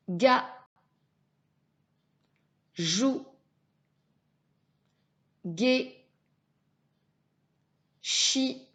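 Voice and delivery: isolated syllables, firmly and carefully articulated; syllable drill. Shown as a demonstration. Teacher models syllables for a pupil to repeat, deliberate drill voice. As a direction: sound out each syllable as a model. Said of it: "pour répéter les syllabes".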